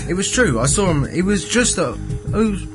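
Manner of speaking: ordinary talk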